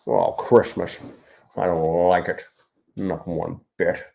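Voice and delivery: Grinch impression. Author doing the Grinch voice for live viewers. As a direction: grumble it out like a grumpy Grinch. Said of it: "Jim Carrey Grinch impersonation".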